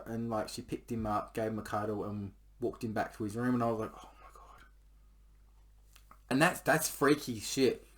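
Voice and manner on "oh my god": breathy whispered relief; relieved whisper. Retold or imitated. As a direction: whisper it, shaken. Relief after kid leaves.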